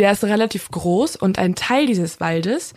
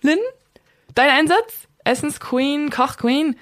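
playful quiz; 7 semitones higher than usual. Acted. Quizzing co-host playfully.

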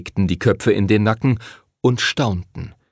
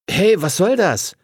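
high-pitched startled complaint; object, voice jumping up; indignant protest; 9 semitones higher than usual. A narrator's reading. Henry protests after Lucy flicks his ear, indignant.

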